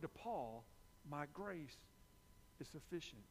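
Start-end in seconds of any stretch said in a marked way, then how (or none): 1.1-3.2 God's gentle answer. Retold or imitated. God's words, softened.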